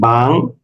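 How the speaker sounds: "বাংলাদেশ" broken as বাং, লা, দেশ; split into syllables. Shown as a demonstration. Teacher showing open and closed syllables, "বাংলাদেশ" split up. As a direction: say "বাংলাদেশ" syllable by syllable, with long gaps. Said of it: "নিঃশ্বাসের ইয়া মতো টানতে পারবো".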